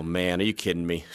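quiet mock disbelief; mock exasperation. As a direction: sound let down by the news. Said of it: "you just give that sigh like".

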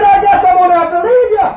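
put-on high, loud shout in the member's accent; loud accented threat. Member loudly threatening to leave the preacher, in a put-on accent. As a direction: yell it like a hammed-up huff. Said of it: "he had an accent".

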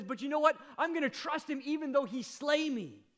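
loud, high and resolute; preacher voicing Job's defiant faith; defiant declaration. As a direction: declare trust at full voice. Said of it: "And then he says".

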